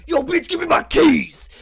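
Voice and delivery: high, loud yelled demand; robber shout. Retold or imitated. Robber yells at the clerk for his keys, a mock high shout. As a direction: bark at her for the keys.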